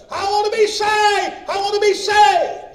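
very high, loud, drawn-out cry; desperate shout. Retold or imitated. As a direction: holler it across the church as the husband. Retold husband cries out in church.

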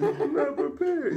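high, laughing imitation of her whine; mock high whine. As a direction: mimic her panicked high squeak. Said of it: "That not prepared was intonation".

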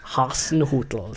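put-on slow, drawn-out Hasenhüttl in an Austrian accent; mock Austrian accent. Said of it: "like, an Austrian accent".

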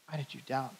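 very soft, pondering repeat; hushed question. A narrator's reading. Preacher repeating Jesus' question to Peter, hushed. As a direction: ask it quietly, barely projected.

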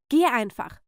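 firm, irritated order to leave; annoyed, controlled command; curt dismissal. Acted. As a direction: send the niece away without a full shout. First dismissal of niece.

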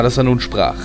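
usual speaking voice

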